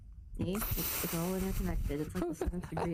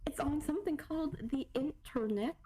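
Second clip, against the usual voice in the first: higher-pitched mock elder; baffled old-timer; 8 semitones higher than usual. Friend joining the bit about cheaters and DNA apps, baffled elder. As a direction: puzzle over a newfangled word.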